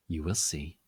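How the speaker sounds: low soft mysterious voice. A narrator's reading. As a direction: tease it with secrecy. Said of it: "she said".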